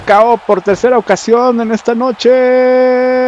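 slow, high, loud call of the finisher; excited move call. Acted. Playing wrestler, calls his move. (anuncio emocionado)

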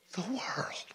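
sudden hushed whisper after the climax. Real, not acted. Preacher to congregation, dropping to a whisper. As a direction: breathe it as a whisper.

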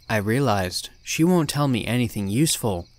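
ordinary delivery

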